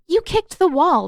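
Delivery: accusing. Acted. Katara accusing Zuko of kicking the wall, loud. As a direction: call him out, caught red-handed.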